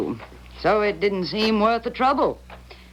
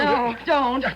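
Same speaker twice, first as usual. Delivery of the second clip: frightened protest; 7 semitones higher than usual. Acted. Gun drawn, Grace protests.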